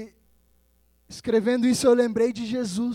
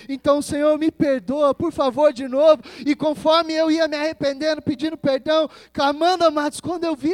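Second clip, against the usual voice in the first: urgent, louder sermon voice; fervent preaching; 4 semitones higher, 7 dB louder than usual. Retold or imitated. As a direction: pour out the retold prayer with force. Retells his repentant prayer, preaching. (pregação fervorosa, voz forte)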